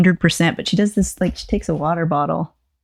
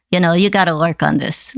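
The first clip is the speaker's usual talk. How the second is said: ordinary talk, nothing marked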